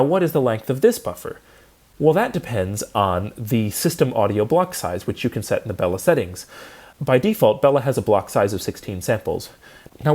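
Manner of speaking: ordinary talk, nothing marked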